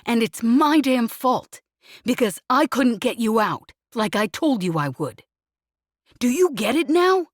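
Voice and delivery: furious rant. Acted. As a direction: pour out guilt and rage at full volume. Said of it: "he snapped furiously".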